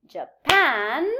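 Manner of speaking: "Japan" with PAN loud and drawn out; stress on PAN. Shown as a demonstration. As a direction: punch the second syllable of "Japan". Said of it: "So the stress moves to the".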